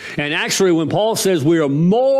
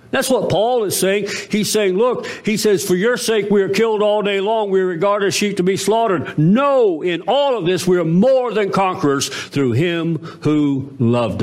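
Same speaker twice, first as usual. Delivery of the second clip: high, raised sermon voice; preaching; 6 semitones higher than usual. Acted. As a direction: build to the climax. Fervent preaching climax.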